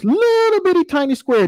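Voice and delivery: LITTLE stretched long and held high. Retold or imitated. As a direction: lean on "little", high and long, before "bitty tiny square". Dad coaches son to focus small.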